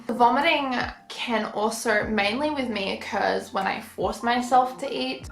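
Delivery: ordinary talk, nothing marked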